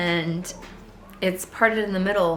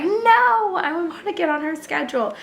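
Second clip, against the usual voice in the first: high, loud panicked cry on "No"; 11 semitones higher, 4 dB louder than usual. Retold or imitated. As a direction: replay the moment of panic, half joking.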